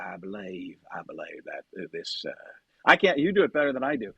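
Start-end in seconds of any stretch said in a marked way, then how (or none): 0.0-2.3 low, soft, drawled Texas murmur; McConaughey impression. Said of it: "the McConaughey".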